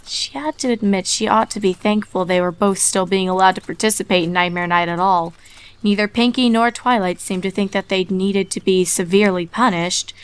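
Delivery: ordinary delivery, nothing marked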